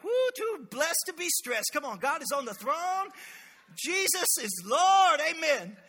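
high, slow gushing churchy cheer; putting on a happy face for the greeters; fake cheerful. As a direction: ham up the blessed act.

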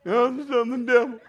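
high, loud wailing daughter voice; daughter crying. Retold or imitated. Daughter wailing at dinner to her dad, loud and high-pitched. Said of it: "she finally just started busting out crying".

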